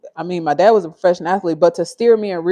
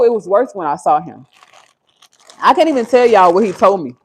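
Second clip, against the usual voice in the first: ordinary delivery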